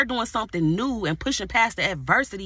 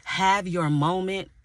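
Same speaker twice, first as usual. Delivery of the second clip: slow emphasis; 3 semitones lower, slower than usual. Acted. Preacher repeats key phrase.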